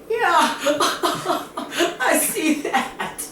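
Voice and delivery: high, drawn-out words through mock hysterics; hammed-up cackling reply; laughing hysterically. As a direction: act helpless with laughter, pitch way up. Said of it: "he's laughing hysterically".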